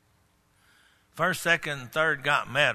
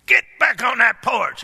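loud, raised-pitch order to a dog; authoritative command; 11 semitones higher, 12 dB louder than usual. Acted. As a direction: order the dog home like you mean business. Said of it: "You have to get authority in your voice".

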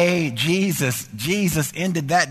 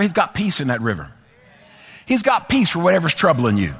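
ordinary delivery

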